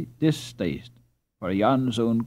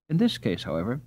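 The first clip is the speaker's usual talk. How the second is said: ordinary delivery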